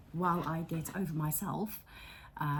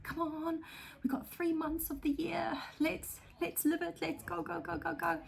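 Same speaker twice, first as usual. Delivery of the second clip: high, urgent cheerleading at herself; rally yourself to grab the summer; mock pep talk; 12 semitones higher than usual. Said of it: "kind of like".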